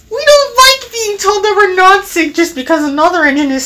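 high, rapid indignant complaint; protesting trucks. A narrator's reading. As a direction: rattle off a high-voiced protest.

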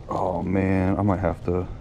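ordinary talk, nothing marked

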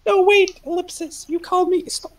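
high, frantic voice of someone in danger; panicked high voice. Acted. Plays panicked caller on phone.

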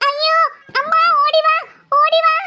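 frightened shout for mother; scared call for help. Acted. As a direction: call mom in a panic. Terrified sister calls for mother.

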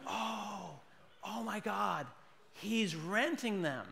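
slow, stunned marvelling; awed realisation. Acted. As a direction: marvel as if it just dawned on you.

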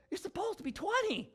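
high, lightly voiced reproach; a hint of a scolding friend; mock scolding. Friend telling the pastor the rule, mock reproach.

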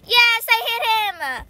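loud, high, drawn-out cheer; triumphant shout. Real, not acted. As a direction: celebrate the hit loudly. Stone hit the enemy.

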